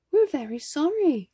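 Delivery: put-on high, quick child's voice; apologetic little girl. A narrator's reading.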